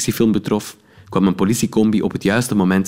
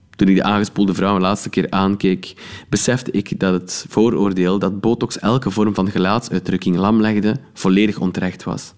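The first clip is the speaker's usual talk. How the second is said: usual speaking voice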